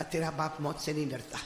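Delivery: low, soft dying father's voice; hushed acted deathbed reassurance; softly reassuring father. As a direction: quietly reassure the daughter. Dying father reassures his daughter.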